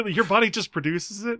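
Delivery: high-pitched mock disbelief; appalled. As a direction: retell it as an appalled, high-voiced question. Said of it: "how appalled".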